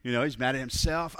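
usual speaking voice

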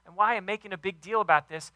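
usual speaking voice

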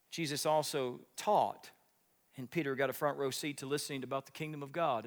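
normal talking voice

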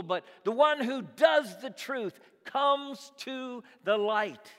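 high, loud, ringing pulpit voice; full-force preaching. Acted. Preaching the light passage.

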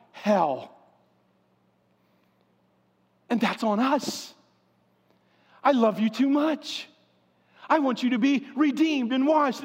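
rising, fervent sermon delivery; preaching. Acted. Pastor urging his congregation to repent, preaching. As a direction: preach it with urgency.

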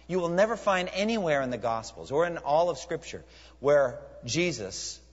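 normal talking voice

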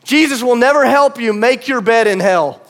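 ordinary delivery

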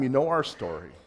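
ordinary delivery

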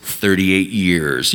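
slow low robotic hold message; phone menu voice. Retold or imitated. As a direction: read it like a recorded phone line. Automated phone queue message.

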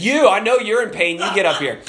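single him out and call him up; calling out a friend. Retold or imitated. Healed man calling his friend in pain forward, mock calling out. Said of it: "he calls out his friend".

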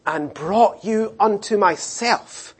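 soft, slow tender promise; tender God. Retold or imitated. God promises to carry Israel, tender and slow. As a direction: speak softly like a loving father. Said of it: "He says".

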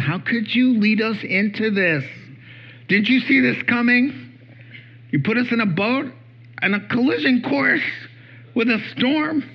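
sarcastic, incredulous complaint; biting sarcasm. Acted. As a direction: throw the blame at him phrase by phrase. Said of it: "They go with biting sarcasm".

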